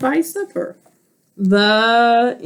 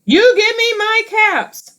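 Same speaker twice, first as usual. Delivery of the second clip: peddler demanding caps; 12 semitones higher than usual. Acted.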